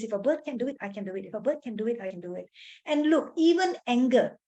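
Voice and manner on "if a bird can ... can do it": softer, lower, as if to yourself; self-reassuring mantra. Acted. Speaker models self-talk for stressful moments, soft murmured mantra. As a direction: repeat it under your voice, dropping away on each answer.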